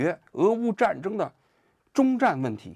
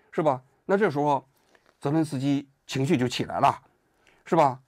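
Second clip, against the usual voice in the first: ordinary talk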